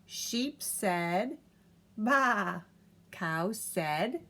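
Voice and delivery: lively picture-book reading; reading to a child. A narrator's reading. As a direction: read it aloud to a toddler, bouncy and clear. Reads picture book aloud.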